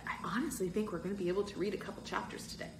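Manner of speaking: usual speaking voice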